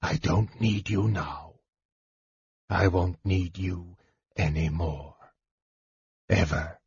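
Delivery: soft, low, hushed voice; whispered. A narrator's reading. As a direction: speak low and quietly, with cold finality. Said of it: "Raistlin whispered".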